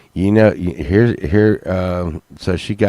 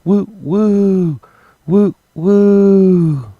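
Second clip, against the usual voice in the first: high hooting woo imitating the fart; fart noise woo; 11 semitones higher, 6 dB louder, much slower than usual. Imitating the fart sound to Justine with a high hoot. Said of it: "It was like".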